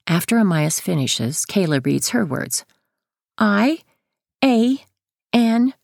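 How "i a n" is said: put-on high, careful child voice; child spelling out. A narrator's reading. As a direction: spell it out like a little kid.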